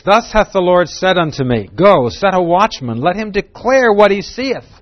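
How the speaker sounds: proclaiming the scripture; scripture reading. Acted. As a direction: read the verse out with weight.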